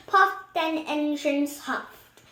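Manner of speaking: ordinary delivery, nothing marked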